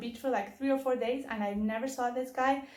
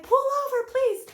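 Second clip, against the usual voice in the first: much higher and louder, desperate yell; frantic shout; 6 dB louder than usual. Retold or imitated. Shouting again at the driver, even more frantic. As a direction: scream it so he finally hears. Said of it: "And I'm like".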